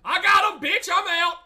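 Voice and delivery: yelling in triumph; triumphant shout. Real, not acted. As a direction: shout the victory out.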